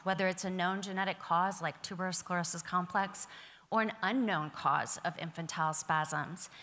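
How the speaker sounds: usual speaking voice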